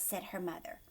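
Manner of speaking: ordinary delivery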